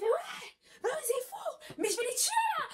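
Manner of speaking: very high, laughing mock rage; mock furious squeal. Girl to passers-by, laughing high mock fury. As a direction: squeal it like you could kill them.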